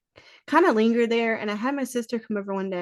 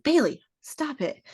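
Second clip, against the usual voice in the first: yelling at dog; 7 semitones higher than usual. Retold or imitated.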